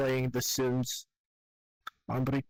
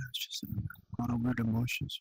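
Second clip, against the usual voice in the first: crying; 4 dB quieter than usual. Real, not acted. Crying over lost childhood cat.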